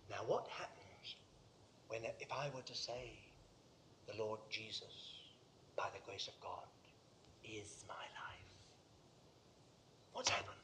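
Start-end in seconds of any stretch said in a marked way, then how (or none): 4.1-8.4 low, halting, near-whispered declaration; hushed confession. Acted. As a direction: confess it softly, phrase by phrase. Hesitant first confession of faith.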